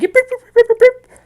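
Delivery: high, loud rapid puppy yips; yelping dog. Acted. Game master imitating a dragged dog, shrill loud yelping. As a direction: yelp like a dog being dragged. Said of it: "you hear the dog".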